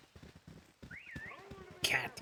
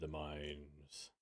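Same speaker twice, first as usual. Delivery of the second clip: much lower and softer, ominous; 13 semitones lower, 18 dB quieter than usual. Acted. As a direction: drop it dark and hushed. Ominous echo of own call.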